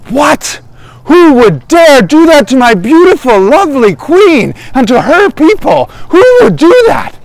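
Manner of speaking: high, indignant outburst; hammed-up royal outrage; outraged king. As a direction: demand to know who dared, voice climbing. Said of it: "He's just so angry".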